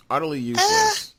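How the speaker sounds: high, loud mocking repeat; exaggerated disgust. Real, not acted. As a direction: ham up how useless it is.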